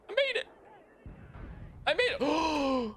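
high shouted triumph; triumphant shout. Real, not acted. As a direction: yell it out in victory. Survives in game.

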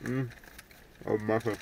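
chewing while talking; words through pretzel bite; mouth full. Real, not acted. Seen 2: Man bites pretzel, then talks while chewing with full mouth.

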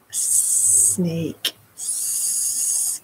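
long hissed "S-" sound; drawn-out s like a snake. Shown as a demonstration. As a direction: hiss "S-" like a snake. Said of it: "can you do that?".

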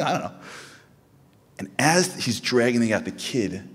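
lively, loud anecdote about a tantrum; excited storytelling. Real, not acted. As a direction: tell it with rising excitement, almost laughing.

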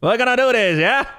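light Aussie twang, crikey mate; mock Australian accent. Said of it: "that was definitely Australian".

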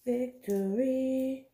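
drawn-out slow victory cry; triumphant. Pretend. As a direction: stretch it like a mock battle win. Opening package triumph.

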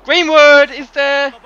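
loud, high, stretched-out goal call; excited shout. Real, not acted. Gamer calls Greenwood's rebound at goal, excited shout. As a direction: belt it out like a live commentator.

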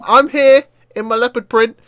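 silly sing-out entrance; imitating the character popping up. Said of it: "just appears around the corner like".

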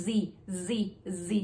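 "Z" with teeth together and a buzzing z sound. Shown as a demonstration. Teacher demonstrating the "Z" buzz to learners. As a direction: buzz the "Z" through closed teeth. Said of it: "แล้วก็ออกเสียง".

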